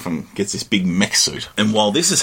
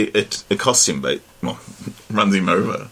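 ordinary talk, nothing marked

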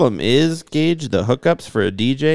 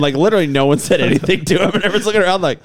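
ordinary talk, nothing marked

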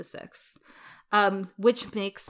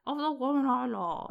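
Edward; 4 semitones higher, 4 dB quieter than usual. Retold or imitated. King quoting his abdication broadcast, mock posh British accent. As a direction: imitate a royal radio address in posh British. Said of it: "Except he says it like".

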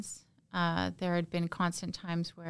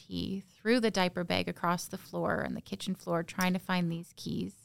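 ordinary talk

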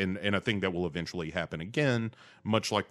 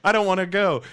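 mock pleading; 11 semitones higher, 6 dB louder, faster than usual. Victim pleading in wicker man.

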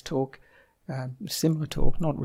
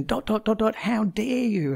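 lightly mocking an angry young speaker; imitating Greta Thunberg; 7 semitones higher than usual. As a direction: parody her repeated indignant refrain. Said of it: "And she'd say".

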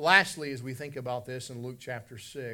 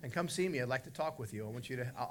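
ordinary delivery

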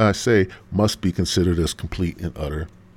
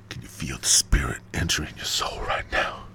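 soft, lower, whispery mock-spiritual murmur; hushed prophet; 6 semitones lower, 6 dB quieter than usual. Stone Prophet to his listeners about the spirit, hushed. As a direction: whisper it like a mystical invitation.

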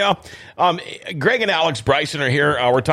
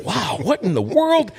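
mock amazement; 8 semitones higher, slower than usual. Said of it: "They're like".

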